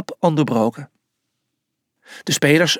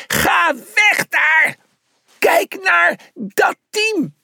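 slow, high-pitched yelling; calling from afar; much slower than usual. A narrator's reading. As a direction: holler each word drawn out to carry far. Shouting to distant brother.

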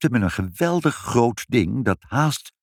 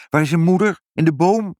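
higher, louder questions; raised questioning; 9 semitones higher, 5 dB louder, faster than usual. A narrator's reading. Father asks child where mother is, raised questioning. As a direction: ask around in a lifted, louder voice.

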